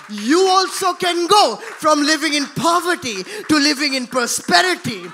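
loud, rising sermon cadence; fiery preaching. Acted. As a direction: preach it out to the crowd. Preacher builds to full force.

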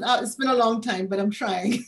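normal talking voice

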